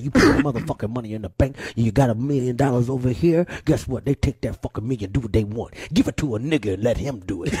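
Dick Gregory impression. Comedian quoting the elder's bank advice, low and gruff. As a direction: growl it out quick like the elder. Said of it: "He said".

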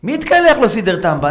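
very high, loud scolding shout; angry shout. Acted. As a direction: yell at the household. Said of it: "אחד שאל".

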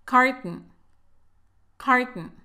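Carton with the second syllable swallowed, kart-n. Shown as a demonstration. Teacher modelling Carton with a swallowed second syllable. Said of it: "Traguémonos esa segunda sílaba".

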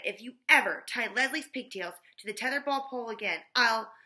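loud, stern warning; stern scolding. A narrator's reading. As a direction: lay down the law. Louis scolding Terrence.

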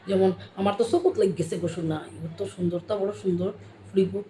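quick, fond Sylheti compliment; Sylheti sweet talk. Shown as a demonstration. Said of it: "আমরা সিলেটিতে কই".

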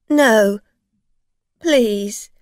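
loud, desperate plea; pleading. A narrator's reading. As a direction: beg her again. Driver begging.

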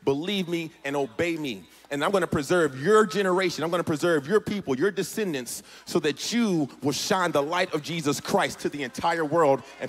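impassioned preaching. Real, not acted. Preacher declaring God's preserving word to the church, impassioned. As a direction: build to full preaching force.

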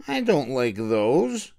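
put-on slow grumbling boy voice; a touch of a growl; mild growl. Harry refuses the brown boots, slow mild growl. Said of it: "growled Henry".

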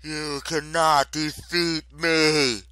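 loud, high, slow proclamation; defiant goddess. A narrator's reading. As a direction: boom it out slowly as a furious goddess. Enraged goddess defies her attackers.

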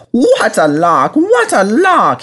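high, drawn-out gleeful engine voice; gleeful chuckling. A narrator's reading. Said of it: "he chuckled to himself".

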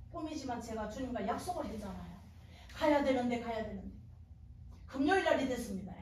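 usual speaking voice

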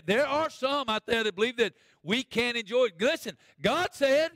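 high, loud pulpit proclamation; full-force preaching. Real, not acted.

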